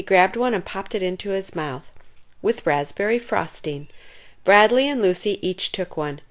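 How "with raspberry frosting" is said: ordinary delivery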